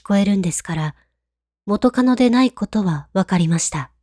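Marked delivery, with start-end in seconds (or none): none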